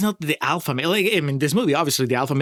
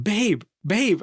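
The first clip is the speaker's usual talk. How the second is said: mock screaming; 8 semitones higher than usual. Retelling the movie's mugging scene, mock screaming. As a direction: imitate a panicked cry without real volume. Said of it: "Elsa keeps screaming".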